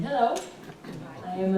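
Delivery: ordinary talk, nothing marked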